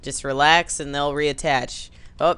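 usual speaking voice

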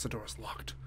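ordinary talk

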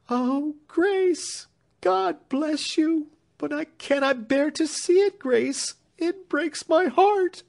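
very high, slow, tearful cry of grief; sobbing. A narrator's reading. Marian sobbing on Grace's neck, very high and slow. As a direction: break down in tears on her sister. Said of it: "fell sobbing on her neck".

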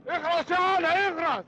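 sharp order to shut up. Acted. Uncle silencing the nephew.

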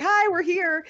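high, chirpy mock hello; mock cheery greeting. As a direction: announce yourselves far too brightly, high-pitched. Imitates atheists turning up unwelcome.

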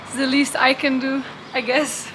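ordinary talk

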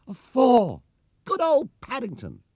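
put-on high, loud boyish cheering; excited cheer. A narrator's reading. Jonathan cheers Paddington's four, high excited shout. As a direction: cheer your friend on.

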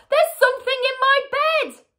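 high, loud panicked shriek; screamed. A narrator's reading. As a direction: shriek it in terror at your husband. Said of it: "screamed Missus Twit, bouncing about".

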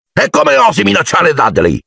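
high, furious roar; roaring uncle. Acted. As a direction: roar the accusation. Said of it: "ruggì zio Vernon nello stesso tono". (ruggito furioso dello zio)

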